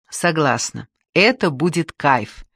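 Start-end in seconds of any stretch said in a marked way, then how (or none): none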